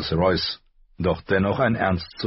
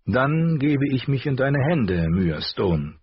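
higher, firm decision; a hint of commanding lady; 7 semitones higher than usual. A narrator's reading. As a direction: entrust herself with authority. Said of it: "befahl Catelyn". (leicht befehlende Lady)

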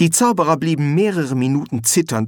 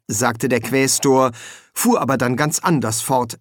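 ordinary delivery, nothing marked

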